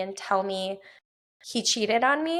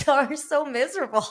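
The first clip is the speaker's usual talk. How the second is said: laughing tease; 5 semitones higher than usual. Retold or imitated. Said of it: "we were just dying".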